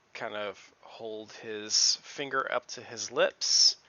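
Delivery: normal talking voice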